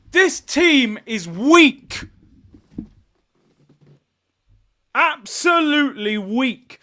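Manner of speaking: very slow, every word leaned on; a drawn-out, damning verdict; slow, weighty verdict. Real, not acted. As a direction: spell out his disgust at the team. Slow verdict on his team.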